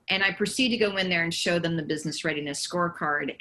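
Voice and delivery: normal talking voice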